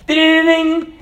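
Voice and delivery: high ringing ding-ding-ding; imitated school bell. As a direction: sing out a bell, high-pitched. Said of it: "And then you hear".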